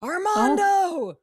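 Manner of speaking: long drawn-out high call; a woman calling her brother Armando, long and high. Retold or imitated. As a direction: call for Armando across the lot, stretched out. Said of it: "she starts going".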